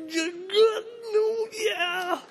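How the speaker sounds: words cracking as emotion takes over; voice breaking. Real, not acted. Preacher reliving meeting the converted kid, crying voice.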